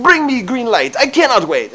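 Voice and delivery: put-on high, loud Russian-accented catchphrase; impatient hammed-up Russian demand; mock Russian accent. Joker to himself in traffic, mock Russian accent. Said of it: "saying things in that accent".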